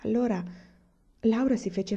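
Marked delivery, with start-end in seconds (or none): none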